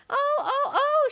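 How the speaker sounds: very high, loud wailing oh; shouting Queen. A narrator's reading. Queen shrieks at her bleeding finger, very high and loud. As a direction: shriek it like a steam whistle. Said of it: "shouted the Queen".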